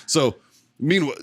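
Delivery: ordinary talk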